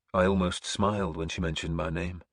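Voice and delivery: ordinary delivery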